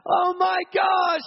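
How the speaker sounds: very high, loud, astonished yell; high shouted "my foot"; amazed screaming. Retold or imitated. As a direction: shriek in disbelief at the healing. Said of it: "screaming out".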